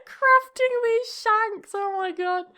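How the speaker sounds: amused disbelief. Real, not acted. Laughing about dog's shanks.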